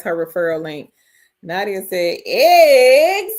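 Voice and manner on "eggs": laughing. Real, not acted. Host reading a funny chat comment, laughing. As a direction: burst out laughing over the word.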